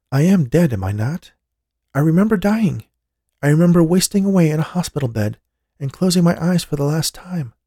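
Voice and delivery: lightly voiced young woman remembering death; a revived woman, bewildered; dead woman's voice. A narrator's reading. Jessica voiced as character.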